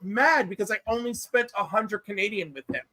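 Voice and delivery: ordinary talk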